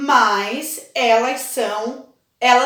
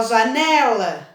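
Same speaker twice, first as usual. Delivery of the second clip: Janela called slowly with a long, strong né; stress on né; slower than usual. Pretend. Teacher showing the stressed syllable of Janela, calling with a strong né. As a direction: stretch the né in Janela as if calling someone. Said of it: "Qual é o que eu falei com mais força?".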